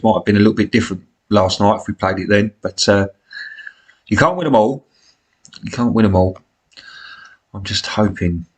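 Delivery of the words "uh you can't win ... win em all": normal talking voice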